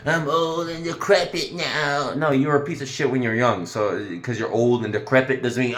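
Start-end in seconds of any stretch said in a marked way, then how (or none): 0.1-2.1 slow, high whiny mock; mocking the old mother. Mocking the mother's act, slow, high and whiny. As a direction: drag it out like a pitiful old woman. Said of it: "Don't say you're acting".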